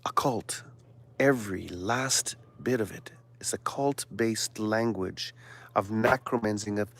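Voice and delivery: soft, secretive whisper. Real, not acted. Warning viewers about occult language, whispered. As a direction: hush it like a dark secret.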